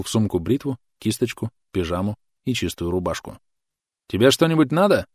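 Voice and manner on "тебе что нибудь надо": raised, louder call from the next room. A narrator's reading. Son calling to his mother from his room, raised voice. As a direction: call it out to someone in another room. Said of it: "крикнул он матери".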